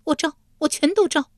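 high, panicked woman's voice; frightened, high-pitched pleading; hasty surrender. A narrator's reading. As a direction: blurt it out desperately to stop the guards. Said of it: "玉莲忙道".

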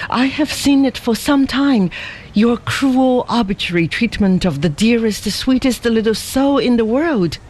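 high, heated scolding of the husband; a girl scolding him with rising anger; indignant accusation. A narrator's reading. Ada berates the deserted husband, high and indignant. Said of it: "with indignant eyes".

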